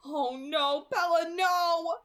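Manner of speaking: high, loud, drawn-out cry of horror; cried in horror. A narrator's reading. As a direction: cry out her name in horror. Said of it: "the angel's voice cried in horror".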